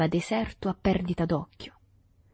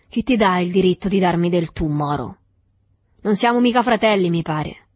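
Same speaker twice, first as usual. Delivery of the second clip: contemptuous; 7 dB louder than usual. A narrator's reading. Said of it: "Sprezzatamente il saro disse".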